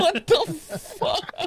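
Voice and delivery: high, stretched-out squeal of disbelief; mock disbelief. Dad stunned by daughter's answer, high mock disbelief. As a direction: squeak it out slowly, high and thin. Dad's stunned reaction, retold.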